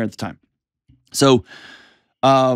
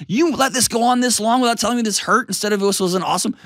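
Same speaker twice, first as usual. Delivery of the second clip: pissed-off wife; 9 semitones higher than usual. Retold or imitated. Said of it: "she was also kinda pissed".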